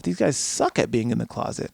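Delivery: ordinary talk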